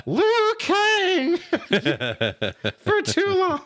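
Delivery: very high, squeaky mock voice; high-pitched mocking impersonation. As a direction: squeak it out as a silly impression. Mocking a movie line, high voice.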